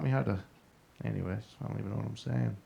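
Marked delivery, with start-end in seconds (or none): none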